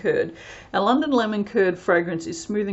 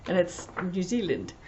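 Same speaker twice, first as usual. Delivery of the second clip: put-on New Zealand accent; 5 dB quieter than usual. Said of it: "do a New Zealand accent again".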